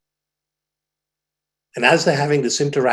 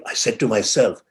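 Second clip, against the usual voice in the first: ordinary talk, nothing marked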